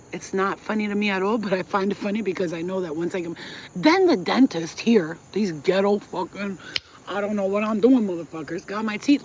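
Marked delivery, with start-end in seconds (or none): none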